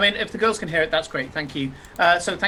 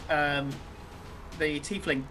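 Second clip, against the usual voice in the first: ordinary talk, nothing marked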